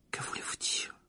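barely breathed question; breathless whisper. A narrator's reading. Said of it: "dans un souffle".

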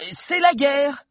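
high, emphatic announcement; dramatic boyish proclamation; young historian dramatising. A narrator's reading. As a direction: declare it as the big turning point. Figure of Valère at the climax.

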